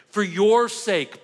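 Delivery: slow and weighty, leaning hard on "your"; an earnest plea, "For your sake" drawn out and stressed on "your"; emphatic. Real, not acted. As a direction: press every word of "For your sake", stretching "your". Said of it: "I'm gonna say it extremely emphatically".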